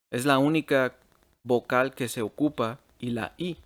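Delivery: ordinary talk, nothing marked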